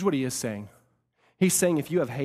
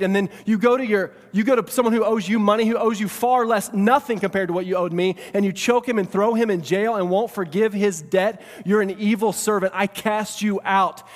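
loud, raised, forceful accusation; indignant rebuke; 8 semitones higher, 8 dB louder than usual. Acted. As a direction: thunder the king's judgment at the servant. King rebukes and condemns servant.

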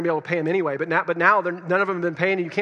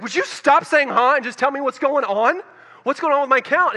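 exasperated demand; 9 semitones higher, 6 dB louder than usual. Retold or imitated. Customer snapping at the banker to stop humming, exasperated. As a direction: demand answers, at the end of your rope.

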